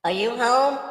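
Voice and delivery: calling down a hallway. Pretend. Ghost calling through a house.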